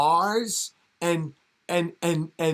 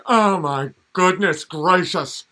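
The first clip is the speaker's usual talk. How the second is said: slow, aghast and a bit higher; mock shocked gasp; 4 semitones higher, 8 dB louder, slower than usual. Viewer without the cartoon, slowly and loudly aghast. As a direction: clutch your pearls in slow horror. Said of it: "you're like".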